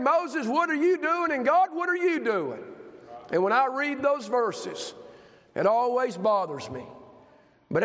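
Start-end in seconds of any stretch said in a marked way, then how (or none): 0.0-2.6 high-pitched imitation of accusing questions; mock complaint. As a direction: challenge them like an aggrieved complainer. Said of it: "you're saying".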